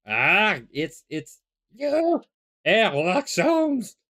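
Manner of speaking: high, loud, drawn-out furious outcry; outraged recognition. Acted. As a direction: spit out the hated name slowly. Recognizing his tormentor.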